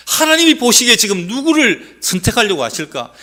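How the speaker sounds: loud, high fervent prayer. Pretend. Preacher modelling how believers should pray, loud and fervent. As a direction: cry out the prayer to God. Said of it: "이렇게 기도해야 됩니다".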